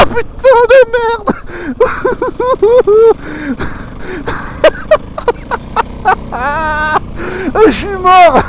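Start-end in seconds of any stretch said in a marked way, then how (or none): 0.0-1.3 panicked cry. Real, not acted. Slides in snow at night. 1.4-7.6 shrieking nervous laugh. Real, not acted. Laughs at his own panic.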